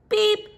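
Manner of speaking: a bright high little horn beep. Acted. Little truck greeting the toad. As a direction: toot it high and quick. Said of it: "said the friendly Little Blue Truck".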